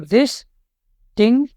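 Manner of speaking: Distinguish in three slow, separate beats: dis, ting, guish; slow syllable-by-syllable distinguish, dis... ting... guish; split into syllables. Shown as a demonstration. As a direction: break distinguish with clear pauses between dis, ting and guish. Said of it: "distinguish को आपको कैसे तोड़ सकते हो".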